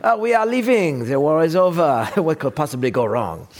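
a hammed-up French diplomat breezily walking away; mock French accent, Frenchman announcing the pullout. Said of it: "Sorry, wrong accent".

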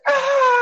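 high, loud scream on waking; retold scream of a wounded soldier. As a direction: let out a sudden loud scream.

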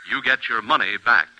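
ordinary talk, nothing marked

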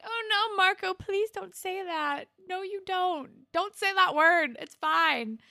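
high, urgent mock pleading; acted-out distressed plea. Pleading with character on screen.

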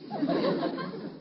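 booming chuckle; hearty laugh. Acted. Laughs at Leroy's milk joke.

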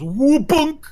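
cartoon whack sound; impact sound effect. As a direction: voice the pipe smacking a head. Pipe hits the player.

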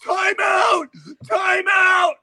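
high, loud shouted time out; a kid yelling to stop the game; mock shout. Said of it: "and he would go".

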